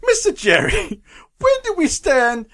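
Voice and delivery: mock Italian Pope with a silly question; hammed-up Italian accent; bad Italian accent. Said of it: "stereotypical bad Italian accent".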